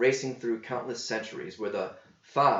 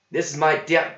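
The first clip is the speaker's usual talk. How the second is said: louder cook voice breaking off on "my"; cook false start; 6 semitones higher, 7 dB louder than usual. A narrator's reading. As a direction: start the threat and cut it. Cook self-correcting threat.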